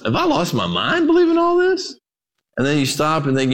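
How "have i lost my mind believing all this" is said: worried parent. Acted. Parent voiced in worried thought.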